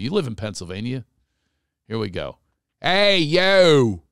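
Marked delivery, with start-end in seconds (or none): 2.8-4.0 loud, drawn-out, hammed-up Philly accent. Shown as a demonstration. Host showing guest how Philly says her sunglasses, broad Philly accent. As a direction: call out in broad, slow Philadelphia vowels. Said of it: "this is how we talk in Philly".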